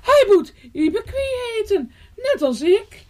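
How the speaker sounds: high bird voice with a laugh; mother sparrow, laughing. A narrator's reading. Mother sparrow laughing as she claims the name. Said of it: "zei Ibekwie".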